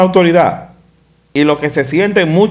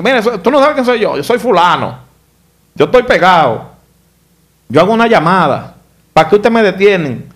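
higher-pitched mock self-important protest; cocky indignation; 4 semitones higher than usual. Imitating a well-connected person berating police, cocky and indignant. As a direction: imitate someone pulling rank, pitched up. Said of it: "ellos se lo comen".

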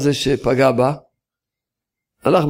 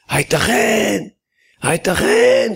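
הייתכן shouted much higher, rising to a cry; shouting; 7 semitones higher, slower than usual. Retold or imitated. The Steipler reading the letter, shouting in disbelief. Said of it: "צעק".

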